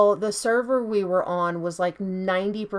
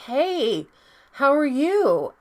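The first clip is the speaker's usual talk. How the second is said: acted friendly greeting; 7 semitones higher, 5 dB louder, slower than usual. Acting out friend's whispered chat message.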